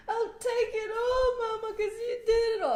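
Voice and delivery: high, loud mock bawling; hammed-up tearful squeal, imitating herself; crybaby wail. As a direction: wail to Mama like a big baby. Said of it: "I was such a baby".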